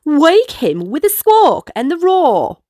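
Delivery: ordinary delivery, nothing marked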